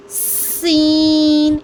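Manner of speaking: सीन drawn out, sharp whistling s. Shown as a demonstration. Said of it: "यहाँ पर सीटी की आवाज़ आनी चाहिए".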